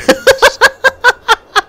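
shrieking high laugh falling away; high burst of laughter. Real, not acted. Gamer laughing at himself, high falling laugh. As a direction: let a high giggle fall off.

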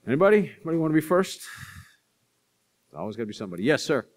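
ordinary delivery

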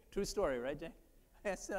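usual speaking voice

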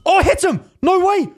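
high, loud burst of triumph; excited shout. Real, not acted. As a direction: yell it, thrilled at the hit. Lands a hit in game.

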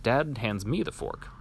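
plain reading voice

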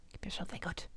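breathy pleading whisper. Shown as a demonstration. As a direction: whisper it softly.

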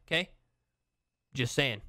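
ordinary delivery

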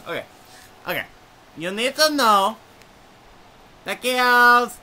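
bright, high little dog voice; lightly voiced cheerful dog. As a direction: greet them like a perky chihuahua. Chihuahua gives love advice.

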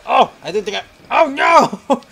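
loud panicked plea; panicked pleading. Real, not acted. Gamer caught by Billy, loud panicked plea. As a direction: beg the monster to stop.

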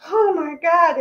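overwhelmed, clutching a pillow; breathless crying-out oh my God. Retold or imitated. Clenching pillow, orgasm continuing.

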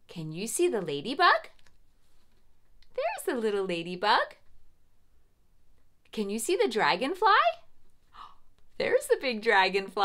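fast, eager pointing out; fast, delighted gasping discovery; quick excited. Acted. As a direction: rattle it off brightly, spotting bugs.

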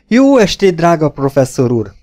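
high, loud cordial greeting; hearty cry. A narrator's reading. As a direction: call out warmly to an old friend. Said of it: "kiáltott a férfi".

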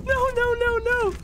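high-pitched panicked cries, close to tearful laughter; really scared. Real, not acted. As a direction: squeal like you just fled a monster. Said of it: "that scared the absolute crap out of me".

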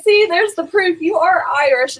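high, gloating told-you-so; showing her husband the proof, high and triumphant. Retold or imitated. Triumphant retold quote to husband.

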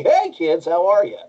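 high, smarmy, over-caffeinated teacher; Koro Sensei voice. Shown as a demonstration. Actor showing his anime teacher's voice, smarmy and high. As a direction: pitch it up high like too much coffee. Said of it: "It's just a little bit more smarmy. It's up in this area".

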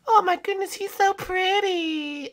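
high, slow princess coo; high cartoon princess swooning over a pet; gushing princess. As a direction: draw it out adoringly, high-pitched. Second princess admires the penguin.

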